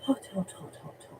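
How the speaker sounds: breathless whisper through a scalded mouth; burnt mouth from hot tea. Real, not acted. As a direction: gasp it out, tongue burning. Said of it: "I'm drinking my tea".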